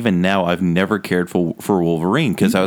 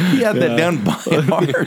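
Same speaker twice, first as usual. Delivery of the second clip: laughing while talking; amused, laughing it out; 8 semitones higher than usual. Real, not acted.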